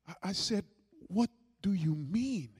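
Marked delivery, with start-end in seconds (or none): 1.1-2.5 stunned question. Retold or imitated. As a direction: reenact a stunned reply, without shouting. Stunned reply to wife's confession.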